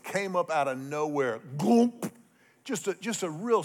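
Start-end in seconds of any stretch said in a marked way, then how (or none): 1.6-2.1 splash sound. Retold or imitated. Imitating the fish rolling.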